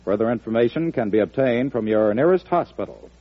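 normal talking voice

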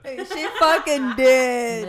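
laughing loudly in agreement. Real, not acted. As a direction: blurt it while cracking up.

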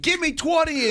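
high mock drill bark; barking gym coach. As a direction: snap the order like a coach. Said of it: "and you're like".

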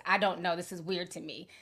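normal talking voice

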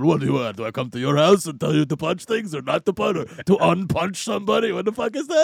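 a hint of a brute's gruff rant; Crusher voice. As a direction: rant like a big bruiser. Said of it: "who is just like".

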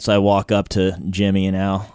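ordinary delivery

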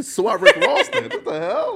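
high, squealing laugh through the words; laughing question. Real, not acted. Host asks about [name] while squealing with laughter.

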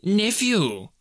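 high, sharp reprimand; stern retort. A narrator's reading. Scrooge silencing his pleading nephew, stern retort. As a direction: cut him off sternly. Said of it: "returned the uncle sternly".

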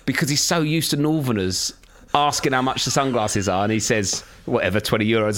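ordinary talk, nothing marked

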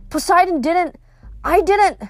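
Percy pleading his innocence to Hades, pitched high; urgent protest. A narrator's reading. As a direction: insist desperately.

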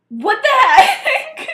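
high, loud, drawn-out outburst; outraged mock shout. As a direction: stretch it out in hammed-up outrage. Acted outraged confrontation of friend.

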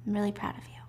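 very soft, hushed, heartfelt praise; tender whisper. Retold or imitated. Mother telling her daughter she is proud, tender near-whisper. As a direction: breathe it out quietly and sincerely.

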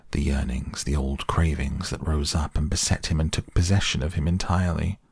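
ordinary delivery, nothing marked

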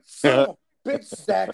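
loud, exasperated retold outburst. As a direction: curse out your buddy in a retelling. Exasperated at friend ending the shoot.

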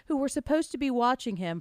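usual speaking voice

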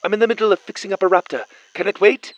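high, rapid radio chatter; walkie-talkie voice. A narrator's reading. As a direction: rattle it off busily over the radio. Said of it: "hissed before a voice came through".

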